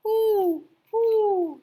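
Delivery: high, loud hooting owl. Acted. Fat old owl scolding the rabbit, hooting. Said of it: "said the fat old owl".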